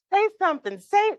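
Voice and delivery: high, eager pestering; excited. Retold or imitated. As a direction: beg them to speak. Retold New Yorkers pestering.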